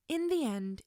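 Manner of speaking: ordinary delivery, nothing marked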